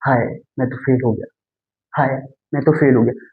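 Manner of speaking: understated despair at failing; mild dismay. Shown as a demonstration. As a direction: lament failing with a sighing हाय. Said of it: "pause आ रहा है उसमें?".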